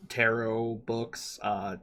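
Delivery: normal talking voice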